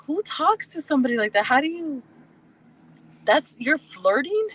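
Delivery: high-pitched indignant disbelief; incredulous. Retold or imitated. Woman retelling her reaction to his flirting, incredulous. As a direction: demand it as if you can't believe the nerve.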